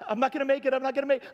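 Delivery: high, loud frantic straining; mock panic. Paddler struggling up a wave, hammed-up panic. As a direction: act out a desperate last push, voice climbing.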